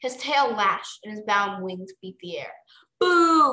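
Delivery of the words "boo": loud, high crowd boo; booing crowd heckle; shouted jeer. A narrator's reading. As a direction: boo them like a rowdy spectator. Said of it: "more dragons in the crowd shouted".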